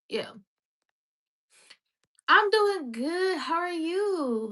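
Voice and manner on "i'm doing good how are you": sing-song greeting. Real, not acted. Answering chat, sing-song.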